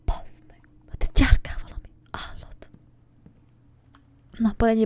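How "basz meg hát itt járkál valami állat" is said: whisper. Real, not acted. Hiding from creature.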